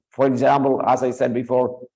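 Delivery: ordinary talk, nothing marked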